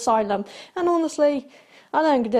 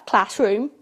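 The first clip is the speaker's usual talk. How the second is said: ordinary talk, nothing marked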